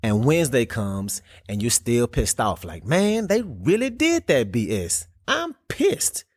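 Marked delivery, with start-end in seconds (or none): 2.8-6.2 higher, put-on mock grumbling; hammed-up annoyed rant; fed-up grumble. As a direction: vent like you're still stewing over it. Imitating yourself still angry midweek.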